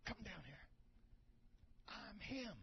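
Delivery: soft whispered aside; whispering to neighbour. Retold or imitated. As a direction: lean in and whisper the question. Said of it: "He said".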